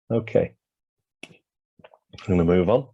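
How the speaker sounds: losing voice, slightly hoarse and slow; hoarse, strained voice. Real, not acted. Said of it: "losing my voice".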